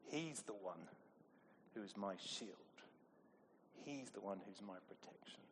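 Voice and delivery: soft, steady quiet trust; calm and settled; a believer declaring trust, soft; quiet confidence. Retold or imitated. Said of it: "with that posture of".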